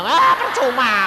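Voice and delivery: loud, slow scoffing cry; dismissive shout. Retold or imitated. Preacher scoffing at his friends, slow loud shout. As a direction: throw the words away loudly. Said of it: "aku bilang".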